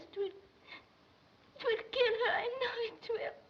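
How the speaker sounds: halting plea on the edge of crying; tearful pleading. Acted. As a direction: beg through tears, with long pauses. Girl begs him to spare mother.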